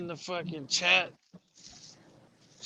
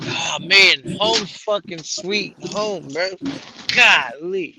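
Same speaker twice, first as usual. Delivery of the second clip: relieved shout; 3 semitones higher, 10 dB louder, slower than usual. Real, not acted. Relieved to be back home.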